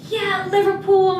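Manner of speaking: slow, drawn-out mock cheer; cheering kids. British schoolkids cheering the cup win, drawn-out cheer. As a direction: cheer it like the crowd.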